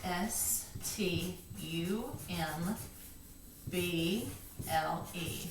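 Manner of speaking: slow letter-by-letter spelling; name each letter slowly for someone writing it down; letters named. Shown as a demonstration. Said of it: "let's name and write".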